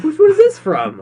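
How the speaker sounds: squeaky, shrill-high mock surprise; high-pitched squeal. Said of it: "I was like".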